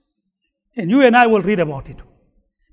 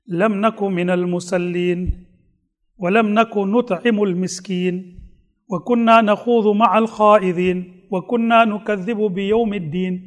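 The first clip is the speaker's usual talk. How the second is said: slow recitation; 4 semitones lower, 3 dB quieter, slower than usual. Acted. Reciting Quranic verse in Arabic.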